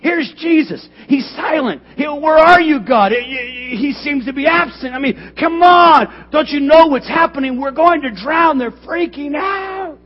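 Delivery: loud, high, shouting panic; full-force preaching. Acted. Pastor voicing the terrified disciples, shouting.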